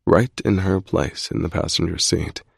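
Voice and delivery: ordinary delivery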